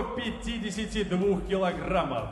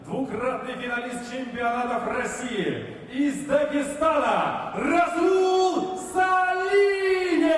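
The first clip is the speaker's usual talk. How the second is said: long booming sung-out name; boxing ring announcer; 6 semitones higher, 4 dB louder, slower than usual. Real, not acted. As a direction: stretch the name out for the arena. Ring announcer shouts fighter's name.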